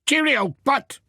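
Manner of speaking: cheerful workman warning the postman back; Peter's voice. Acted. Farmer telling Pat to stand back, high and hearty. Peter answers Pat's goodbye.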